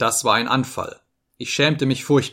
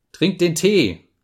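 sharply raised pitch, irritated; annoyed order; 7 semitones higher, 4 dB louder, slower than usual. A narrator's reading. Man taking his anger out on Lisa, annoyed command. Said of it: "sagte ich ärgerlich".

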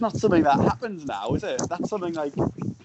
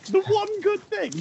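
very high, squeaky mock repeat; incredulous echo. Echoing the friend's remark about babies, squeaky mock disbelief. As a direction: pitch THE ONE GOOD THING way up, amused.

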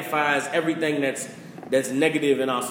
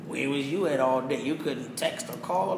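1 mock nagging; 2 semitones lower, 6 dB quieter than usual. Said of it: "she not say".

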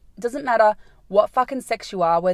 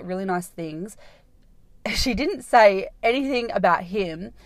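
ordinary delivery

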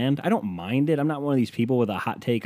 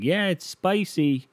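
much higher, slow proud "Yeah, it's spicy."; 8 semitones higher, slower than usual. Retold or imitated. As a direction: sell it as daring heat. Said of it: "you'll just be like".

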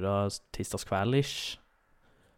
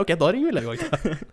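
raised, louder resolve; mock decisive; 8 semitones higher, 5 dB louder than usual. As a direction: take charge in a hurry. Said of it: "Jeg bare".

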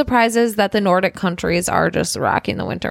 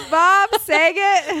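laughing; 10 semitones higher, much slower than usual. Real, not acted. Corrects the name, laughing.

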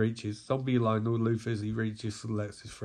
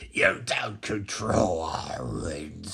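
slow, low monster growl; growling villain; 8 semitones lower, slower than usual. Acted. Red Lantern Atrocitus objects.

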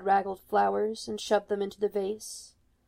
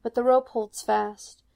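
plain reading voice

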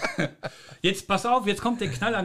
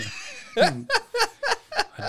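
stifled giggles. Real, not acted. Laughing fit before song.

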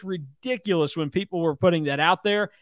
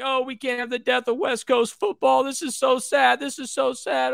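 higher, whiny mock lament, lightly done; mock crying; 7 semitones higher than usual. Mocking pundits mourning West Coast football, higher whiny mock sob. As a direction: ham up a weepy pundit's grief. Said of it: "everybody was crying and saying".

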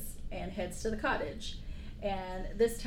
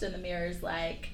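ordinary talk

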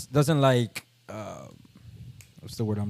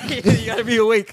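laughing; 5 semitones higher, 3 dB louder than usual. Pretend. Laughing quote of Tesla.

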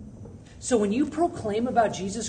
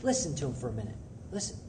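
soft inner voice; 6 semitones lower, 10 dB quieter, faster than usual. Retold or imitated. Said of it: "the Holy Spirit speak to me and say".

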